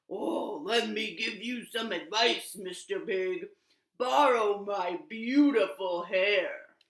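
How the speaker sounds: a hint of a big slow lion; grand lion offering his mane. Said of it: "he said".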